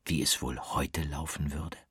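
hushed whisper, wondering aloud. A narrator's reading. Narrator wonders to the listener in a whisper. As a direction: breathe it out softly.

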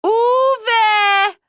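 loud high yell through the house; mother bellowing. A narrator's reading. Mother yelling for the family, full loud shout. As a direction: bellow the names across the house. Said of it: "brüllte meine Mutter".

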